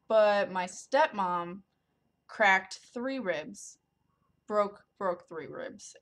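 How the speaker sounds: slow, heavy emphasis on each word; grave listing of stepmom's injuries; slow and deliberate. Real, not acted. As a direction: lay out the injuries slowly.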